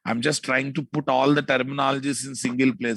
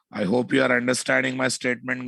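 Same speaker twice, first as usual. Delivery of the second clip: ordinary talk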